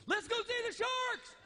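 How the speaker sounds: put-on high excited child's shout; excited kid. Son bursting into the bedroom, high excited kid voice. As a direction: squeal it like an eager little boy. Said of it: "He comes bolting ... goes".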